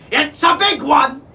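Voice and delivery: high, loud squawk from the mast; shrieking parrot lookout. A narrator's reading. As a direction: squawk it down to the captain. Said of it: "he squawked down to the captain".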